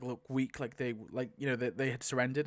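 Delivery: normal talking voice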